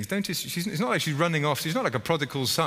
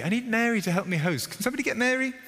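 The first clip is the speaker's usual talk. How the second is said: exasperated Martha; 10 semitones higher than usual. Retold or imitated. Martha calling for help.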